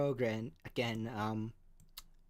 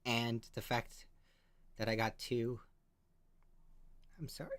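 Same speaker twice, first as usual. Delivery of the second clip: choked up; 2 semitones lower than usual. Real, not acted. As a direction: get through it while tears rise. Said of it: "Oh, I'm so emotional".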